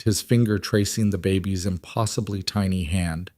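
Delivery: plain reading voice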